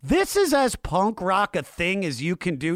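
ordinary talk, nothing marked

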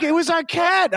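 very high, loud mock-distraught wail. Mother defending her grief over the cat, mock wail. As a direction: imitate an overwrought mother protesting. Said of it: "my mom calls me weeping".

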